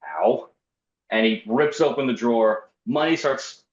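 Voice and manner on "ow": mildly put-out, lower ow; a bored, barely bothered ow; inconvenienced ow. Retold or imitated. Teller shot by robber's drawer, mildly inconvenienced ow. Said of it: "like, an inconvenienced".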